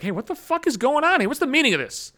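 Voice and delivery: high-pitched mock indignant demand; alarmed buddy. Buddy walking in on the fuss, mock indignant.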